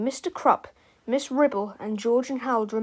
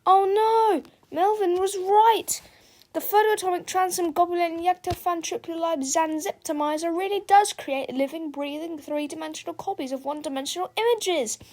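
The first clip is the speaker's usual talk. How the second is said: high-pitched alarmed exclamation; convoluted cry; 7 semitones higher than usual. A narrator's reading. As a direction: cry out in alarm, then reel off the jargon. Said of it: "Harold cried convolutedly".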